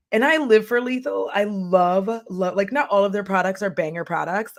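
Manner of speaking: usual speaking voice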